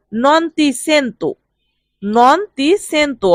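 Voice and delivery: very slow, careful Italian; deliberate teacher's dictation pace; slow model phrase. Shown as a demonstration. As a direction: spell each syllable out for learners. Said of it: "teacher models Italian phrase slowly".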